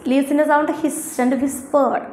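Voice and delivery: ordinary delivery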